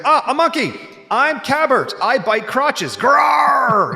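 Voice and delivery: high, laughing monkey with a growl. Retold or imitated. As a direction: growl like a silly school-play monkey. Kid playing monkey character.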